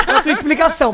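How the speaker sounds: laughing. Real, not acted. Laughs after interrupting.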